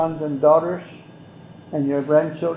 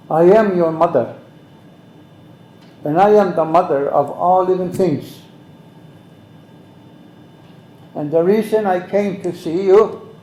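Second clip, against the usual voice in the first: lightly voiced Mother Earth, raised and solemn; 3 semitones higher, 7 dB louder than usual. Speaker voicing Mother Earth's message to him, solemn and raised.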